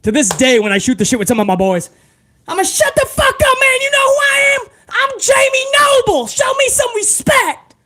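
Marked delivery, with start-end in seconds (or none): 2.5-7.8 high shouted trailer park wrestler voice; much higher and shouting, cocky wrestler; Jamie Noble impression. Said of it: "so I was always emulating him".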